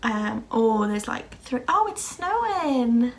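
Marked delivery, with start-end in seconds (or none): 1.7-3.1 sudden delighted pitch jump, slower; real surprise at the window; surprised. Real, not acted. Noticing snow mid-sentence while filming, surprised.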